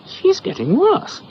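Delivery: high, loud, slow fuss; doctor fussing as crockery flies; mock alarm. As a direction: exclaim in comic worry. Doctor alarmed, slowed high line.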